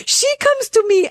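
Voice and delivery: laughing through the words; talking while laughing. Real, not acted. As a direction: giggle it out, high and amused.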